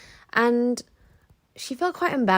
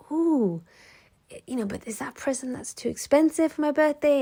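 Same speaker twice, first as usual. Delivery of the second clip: higher, lightly voiced girlfriend, coy and pleased; coy excitement; 6 semitones higher than usual. Girlfriend to her boyfriend, hiding her excitement, higher and coy. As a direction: play her as a thrilled girl pretending modesty.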